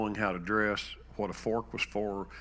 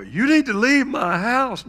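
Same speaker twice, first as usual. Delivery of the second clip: much higher, louder shrill-pitched command; shouted order; 13 dB louder than usual. Retold or imitated. Mother ordering the son she no longer knows out, shouting.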